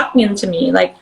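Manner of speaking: ordinary talk, nothing marked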